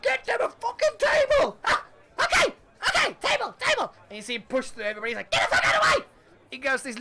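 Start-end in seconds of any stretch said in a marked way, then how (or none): 0.0-1.6 very high, loud gnome voice; shrill little gnome, eager to please; tiny gnome shouting orders, high and loud; squeaky gnome. Acted. 1.7-3.9 very high, eager gnome voice; squeaky gnome. Acted. Gnome answering, hurried. 5.3-6.1 very high, loud gnome voice; shrill little gnome, eager to please; tiny gnome shouting orders, high and loud; squeaky gnome. Acted. Gnome shoving through the crowd.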